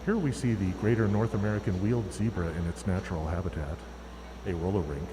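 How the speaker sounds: hushed, low wildlife narrator; nature documentary. Pretend. Mock wildlife narration of referees.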